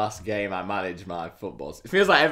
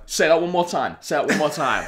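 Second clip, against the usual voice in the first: imitated threat, higher and louder; mock squaring up, raised voice; riled challenge; 7 semitones higher, 6 dB louder than usual. Furious rival manager daring him to repeat it, mock threatening.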